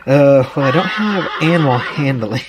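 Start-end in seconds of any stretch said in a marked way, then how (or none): none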